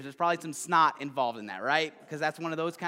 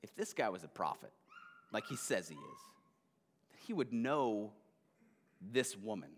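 Simon's inner voice; 6 semitones lower, 8 dB quieter than usual. Acted. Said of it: "and he's like".

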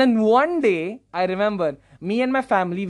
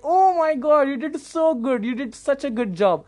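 high-pitched auntie praise, easing down; excited high lady voice, retold; gushing older ladies; 6 semitones higher than usual. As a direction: imitate delighted ladies heaping on praise. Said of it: "these adult ladies, like, my mother's age".

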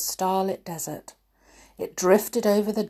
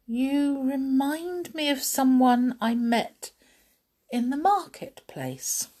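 princess voice; 7 semitones higher than usual. Acted. Jasmine voiced.

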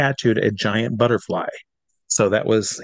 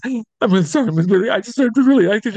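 much higher, straining teary voice; teary apology; 11 semitones higher than usual. Retold or imitated. Tearful fan apologizing to the performer, high and choked. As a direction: blubber out the apology. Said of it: "I says".

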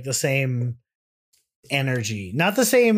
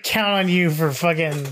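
ordinary talk, nothing marked

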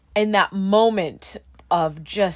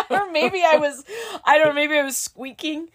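high, loud, giggly speculation; amused and pitched up; laughing; 9 semitones higher, 5 dB louder than usual. Real, not acted. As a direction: talk through embarrassed laughter. Laughing about her own noises.

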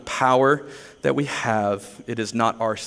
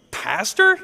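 Pastor? rises high in disbelief; a stunned, questioning echo; incredulous; 9 semitones higher than usual. Retold or imitated.

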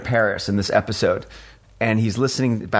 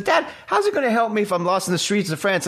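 put-on much higher, laughing kid voice; high mock-kid voice with a laugh; exasperated kid; 12 semitones higher than usual. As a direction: play the kid, cracking up. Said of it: "And he says".

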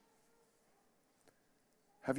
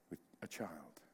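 whispered; 8 semitones lower, 15 dB quieter than usual. Real, not acted. Trailing off tenderly.

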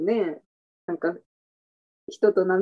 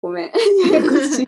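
laughing; 5 semitones higher, 7 dB louder, faster than usual. Real, not acted. Laughing at own confusing explanation.

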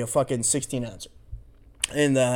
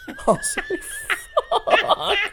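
squealing through laughter; laughing; slower than usual. Real, not acted. Laughing at his own story to friends, squealing laughter. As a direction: laugh the word out high.

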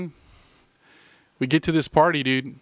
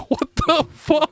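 laughing; 14 semitones higher, slower than usual. Real, not acted. Laughing disbelief.

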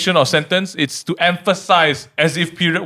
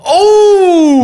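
excited; 13 semitones higher, 5 dB louder than usual. Real, not acted. Reacting to the answer.

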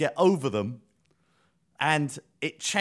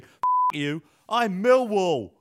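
mock defiant yell, higher, louder, drawn out; retold screamed battle cry; 6 semitones higher, 5 dB louder, slower than usual. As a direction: bellow it slowly as a football fan's war cry. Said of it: "shouting his own battle cry back at them, screaming".

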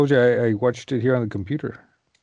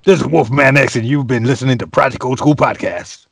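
radio announcer; 9 dB louder than usual. Acted. Radio-style sign-off.

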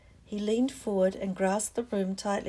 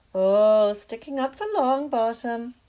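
put-on taunting girl; 3 semitones higher, 9 dB louder than usual. Slytherin girl taunts Parvati.

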